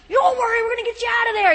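urgent loud reassurance; rescuer calling reassurance. Retold or imitated. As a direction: imitate someone hollering comfort. Rescuer calls reassurance through door.

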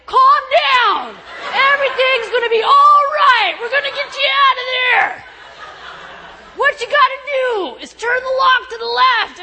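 high, loud yell through a door; shouting rescuer. Retold or imitated. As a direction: imitate someone hollering instructions to a trapped person.

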